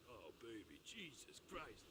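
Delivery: low, drawn-out mock ecstasy; mock lustful moan. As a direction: imitate a lover, stretching the words out. Retold lover's groan, repeated gag.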